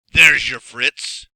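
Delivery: loud, slow gloating magician; menacing magician. Acted. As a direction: savour the cruel reveal. Magician reveals the duck.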